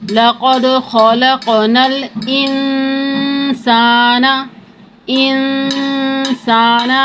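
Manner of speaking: slow chanted tajweed recitation; Quran recitation. Shown as a demonstration.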